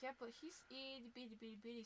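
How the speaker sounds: ordinary talk, nothing marked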